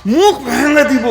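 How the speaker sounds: high, sharp mock outburst of rage; imitating a furious cleric; angry threat. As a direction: bark the threat like you've lost your temper. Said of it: "তা আমি খুব রেগে গেছি".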